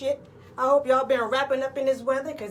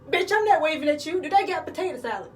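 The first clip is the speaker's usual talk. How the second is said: higher, exasperated comic snap; sassy snap; 4 semitones higher, faster than usual. Retold or imitated. Telling a waving woman off, higher exasperated snap. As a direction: set her straight, exasperated.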